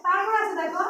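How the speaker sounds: ordinary delivery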